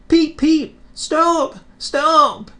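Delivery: high, loud panicky little engine; alarmed whistle. A narrator's reading. Thomas going too fast begging to stop, high and alarmed. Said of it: "he whistled".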